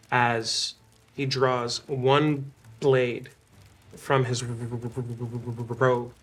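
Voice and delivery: slow, drawn-out storytelling; slow dramatic narration. A narrator's reading. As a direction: draw each word out for suspense. GM narrates slowly, building tension.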